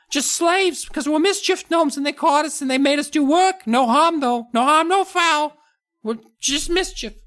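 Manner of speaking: tiny gnome proud of mischief; mischievous gnome voice. Acted. Gnomes bragging to the kids about mischief, very high.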